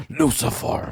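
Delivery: soft spooky whisper. Pretend. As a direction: breathe it like a dark secret. Spooky Satan joke.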